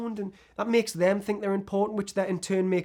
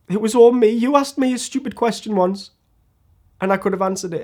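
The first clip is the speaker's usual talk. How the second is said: loud, higher-pitched burst of self-blame; impassioned confession; 3 semitones higher, 6 dB louder than usual. Acted. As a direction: blurt out the admission with force. Emotional apology to only friend.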